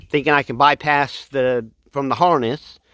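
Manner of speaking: ordinary delivery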